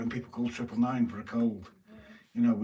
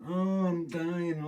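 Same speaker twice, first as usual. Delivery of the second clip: slow drawn-out mock suffering, higher; mock dramatic dying; 7 semitones higher, much slower than usual. Mocking people who fuss over injuries, slow hammed-up whine. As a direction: ham up a self-pitying patient, slowly. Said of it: "I know people".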